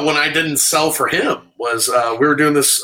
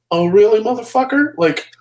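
mock outrage; 9 semitones higher than usual. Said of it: "that look of".

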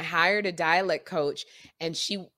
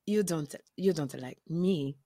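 lower, deliberate Botswanan accent, slowing at the end; deep Botswanan accent; 4 semitones lower, 6 dB quieter than usual. Shown as a demonstration. Actress answers the director in her audition, deep Botswanan accent. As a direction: drop the voice into a careful Botswanan accent. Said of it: "why my voice got so deep".

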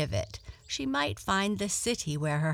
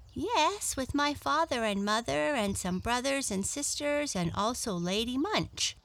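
high, eager animal voice; friendly little rabbit; 6 semitones higher than usual. Acted. Rabbit telling Blackie about his family, high and eager.

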